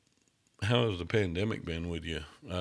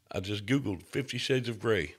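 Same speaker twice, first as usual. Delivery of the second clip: normal talking voice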